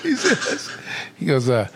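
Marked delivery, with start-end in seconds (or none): none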